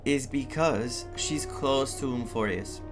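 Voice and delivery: usual speaking voice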